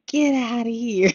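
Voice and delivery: high, loud mock yell of a grandson; imitated playful yell. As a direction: yell it like a kid playing in the pool. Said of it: "he'll be yelling".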